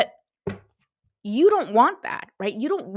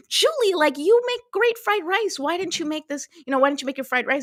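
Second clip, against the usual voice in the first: high pleading complaint; 6 semitones higher than usual. Retold or imitated. Complaining to friend Julie.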